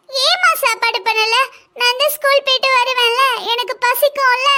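put-on high, whining child voice; hungry child. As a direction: complain to mum like a hungry kid. Hungry child complains to mother.

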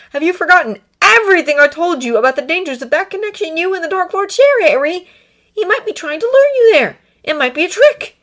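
high, loud, pressing voice; urgent warning. A narrator's reading. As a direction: warn him off urgently. Draco arguing with Harry.

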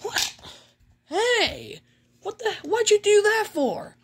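high-pitched cartoon indignation; acted protest. Mickey protests at being attacked.

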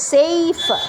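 সেইফার with the vowel drawn long. Shown as a demonstration. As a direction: hold the ei in সেইফার. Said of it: "এটা অনেক long". (লম্বা করে সেইফার)

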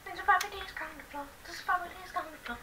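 fast, high, squeaky chatter. Pretend. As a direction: rattle it off in a tiny high voice. Repeating a line in put-on voice.

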